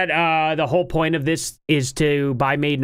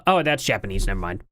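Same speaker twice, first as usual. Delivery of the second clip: ordinary delivery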